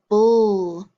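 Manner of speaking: BULL as a loud drawn-out "bul" ending; isolated syllable sound. Shown as a demonstration. Teacher drilling sound cards, BULL as a loud held syllable. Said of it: "do our letter sound cards now".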